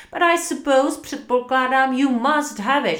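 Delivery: high, loud irritated boss; exasperated, reluctant allowance; grudging concession. A narrator's reading. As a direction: give in crossly to your clerk. Scrooge grudgingly gives in.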